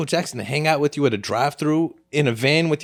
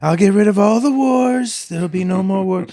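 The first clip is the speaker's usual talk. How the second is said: Trump impression; 5 semitones higher than usual. Mocking his end-of-campaign promises, in a put-on politician's voice. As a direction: imitate a politician making sweeping promises. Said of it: "It's a pretty good Trump impression".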